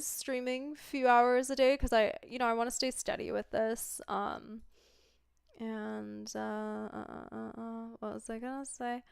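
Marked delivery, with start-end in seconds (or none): 5.6-9.0 stoned and losing the thread; trailing off, stoned and quiet; stoner. Real, not acted. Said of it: "I'm sorry, I'm stoned, I forget".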